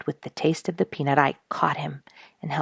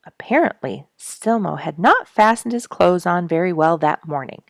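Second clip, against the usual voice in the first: ordinary delivery, nothing marked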